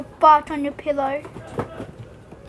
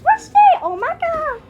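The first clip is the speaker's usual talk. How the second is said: surprised; 14 semitones higher, 8 dB louder than usual. Real, not acted. Surprised in game.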